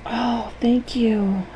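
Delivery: lightly voiced, slow grateful thanks; grateful little character. Anger thanks you for his new name, lightly voiced gratitude. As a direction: draw out the thanks, a touch relieved. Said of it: "And he goes".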